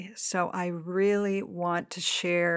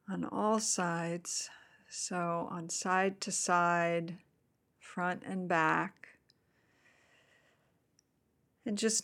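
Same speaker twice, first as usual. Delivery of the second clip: guided meditation. Acted. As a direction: gently guide them into the body.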